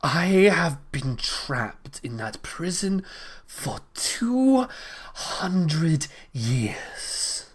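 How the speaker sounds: a lightly voiced, haughty storm goddess; grand, slow words from an ancient spirit; storm queen speaking. Storm queen addresses the mortal, haughty and slow.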